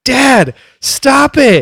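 high, loud plea to a parent; exasperated scolding. Retold or imitated. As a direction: reenact an embarrassed kid telling dad off. Embarrassed child scolds dad, retold.

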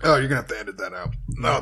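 choking on a drink; coughing out words after a swallow; spluttering water. Real, not acted. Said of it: "that went down the wrong hole".

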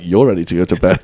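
low deadpan comeback; dry, unbothered retort. Retold or imitated. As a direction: toss it back deadpan, low in pitch. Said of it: "it's always, like, deadpan".

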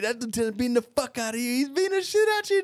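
high-pitched, whooping mock taunt; excited taunt. Teller re-enacts ribbing his buddy after the beating, excited taunt. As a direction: crow it high, like a hyped-up spectator.

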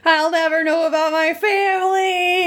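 high hammed-up sob; mock crying. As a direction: melodramatic wail, mock weeping. Credence voiced lamenting.

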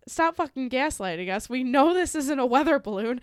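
mock officials' anger. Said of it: "U S officials were like".

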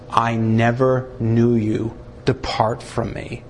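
Jesus speaking at the judgment, slow and grave; Jesus rejecting. Acted. Jesus to the unsaved at the judgment throne, solemn and grave. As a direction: pronounce the sentence solemnly. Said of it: "It's Jesus who's gonna be the one to say".

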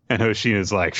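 usual speaking voice

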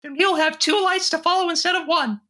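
squeaky-high loyal mouse friend; high cartoon mouse voice.

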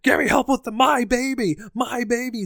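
high, loud panicked yell; retold yelling. Tommy yelling at the nurse for help, high and loud. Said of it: "he's yelling at this nurse".

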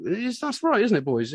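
a high jump on "Is", then easy; lightly voiced cheerful host; imitating Guy. Guy drawing the band into answering, lightly mimicked. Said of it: "sort of go".

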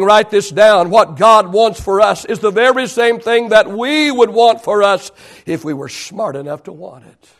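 normal talking voice

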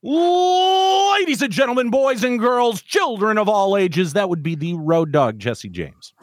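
high booming announcer intro, falling; ringmaster. Retold or imitated. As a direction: sing out like a ring announcer. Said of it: "you didn't say that right".

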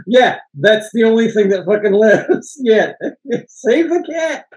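real laughter breaking into the words; amused, through laughter. Real, not acted. Cracking up over the cat.

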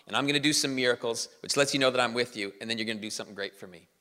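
low, offhand divine pep talk; God. Acted. God bluntly sizing up weak Gideon, low and offhand. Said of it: "this is how I would show up on the scene".

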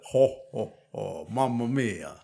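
put-on a light Italian accent on a jolly Santa, slowing down at the end; mock Italian Santa. Dad echoing his son's Santa in a playful Italian accent. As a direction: ham up Santa with a hint of Italian. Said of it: "your Italian Santa".

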